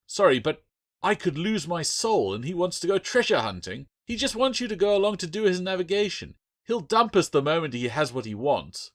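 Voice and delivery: high, urgent complaint; heated accusation. Acted. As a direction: pile on the blame, pitched high. Adrian keeps accusing Bill.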